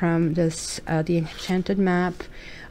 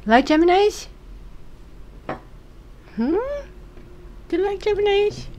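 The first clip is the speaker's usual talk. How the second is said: much higher, sing-song cat talk; 13 semitones higher than usual. Real, not acted. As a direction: coo up high at the cat.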